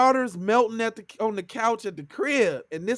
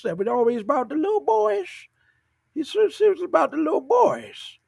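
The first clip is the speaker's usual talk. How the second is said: high-pitched old-man impression; creepy old cartoon man; 7 semitones higher than usual. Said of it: "He sound like that one old dude on The Simpsons".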